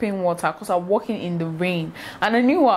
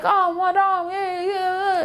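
much higher pleading voice; gate man pleading; 12 semitones higher, much slower than usual. Retold or imitated. Gate man begging the mother to spare the girl, high mock plea. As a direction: beg the madam to calm down. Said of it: "the gate man came, was like".

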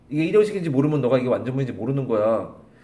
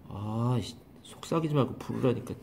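much softer, a fed-up grumble under the breath; exasperated mutter; 4 semitones lower, 10 dB quieter than usual. Real, not acted. Teacher annoyed that the student keeps whispering, soft fed-up grumble.

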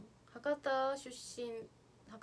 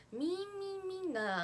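cicada imitation; 9 semitones higher, 3 dB louder than usual.

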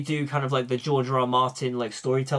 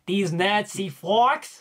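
slow, higher-pitched imitation of a film line; mock movie-hero line, slow and emphatic; Barry Keoghan's iconic line; 5 semitones higher, much slower than usual. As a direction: draw out the quoted line, pitched up. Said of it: "his most iconic line is when he's just like".

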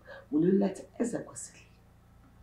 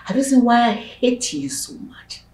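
loud, higher, venomous; hateful outburst; 6 semitones higher, 10 dB louder than usual. Acted. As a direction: throw the hatred at her. Stepmother confesses hatred.

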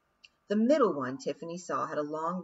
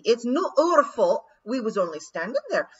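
loud, higher Scots pleading; loud protest; 6 semitones higher, 10 dB louder than usual. A narrator's reading. Rob Anybody pleading to the lawyers, loud Scots protest. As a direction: protest innocence loudly.

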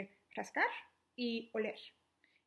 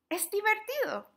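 high and loud with delight; cheerful; 9 semitones higher, 5 dB louder than usual. Acted. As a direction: cheer the kids on. Encouraging kids.

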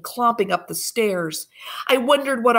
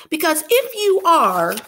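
put-on frightened little girl; 10 semitones higher, 6 dB louder than usual. Marie frightened, cut off.

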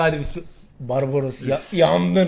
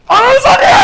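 sudden high yell of pain; shout; 9 dB louder than usual. Real, not acted. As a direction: blurt it out at the top of the voice. Hot pepper hits, swearing outburst.

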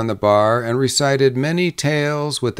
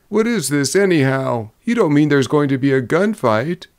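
agitated, higher-pitched questioning; crying out; 5 semitones higher than usual. A narrator's reading. Drummer alarmed about a gunfight, crying out. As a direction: demand to know, voice raised in pitch. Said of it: "he cried".

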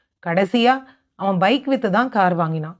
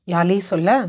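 ordinary delivery, nothing marked

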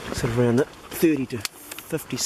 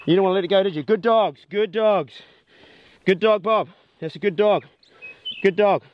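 high, louder praise for the dogs; excited praise; 7 semitones higher, 5 dB louder than usual. Real, not acted. Hunter praising his dogs after their first pig, excited loud praise. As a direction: cheer the dogs on for a job well done.

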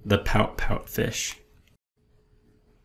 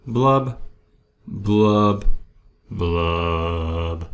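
each blub sinks lower, last one long and deep; glum blubbing; 5 semitones lower, much slower than usual. Acted. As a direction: bubble it out, sinking into gloom. Fish's sinking refrain.

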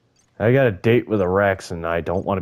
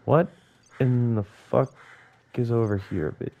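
ordinary delivery

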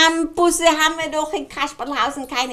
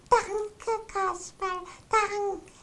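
put-on little girl; 7 semitones higher, 9 dB quieter, much slower than usual. Lara thanks Kasperl.

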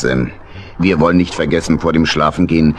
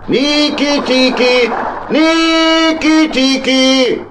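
long, drawn-out call, much higher; calling out loud; much slower than usual. Acted. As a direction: call his friend from the deck, stretching each name. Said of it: "als er Onkel Dominik laut rufen hörte".

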